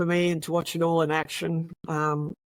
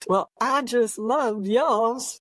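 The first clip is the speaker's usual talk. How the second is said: higher, slow Southern drawl, imitating a detective; Southern accent imitation; 6 semitones higher, much slower than usual. Detective answers her compliment on his accent, imitated Southern drawl. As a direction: stretch it out in a put-on Southern drawl. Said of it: "That's a good imitation, actually".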